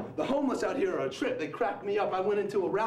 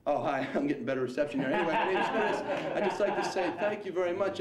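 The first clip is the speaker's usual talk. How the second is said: normal talking voice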